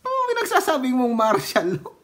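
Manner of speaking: high, fast, scornful question. Real, not acted. Vlogger mocking a rival's martial law claim, scornful. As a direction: sneer at the nonsense.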